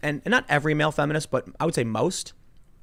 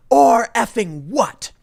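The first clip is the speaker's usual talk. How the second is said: slow, higher, louder taunting challenge; mock confrontation; 8 semitones higher, 7 dB louder, much slower than usual. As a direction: spit it out slow like a dare, imitating her. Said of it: "She lunges into his face and goes".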